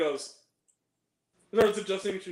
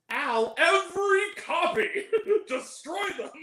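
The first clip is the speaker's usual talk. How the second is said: higher, slow put-on movie voice; quoted movie line; 11 semitones higher than usual. Friend acting out the movie's order, high slow mock voice. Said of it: "and he goes".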